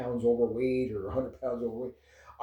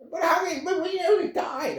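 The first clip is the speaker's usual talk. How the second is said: panicking mom. Retold or imitated. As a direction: mimic your frantic mom in the car. Mom panicking after near crash.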